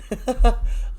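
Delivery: a quick high giggle at her own slip; amused giggle. Real, not acted. Recapping the soap, she giggles at her own slip. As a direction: laugh it off after misspeaking a name.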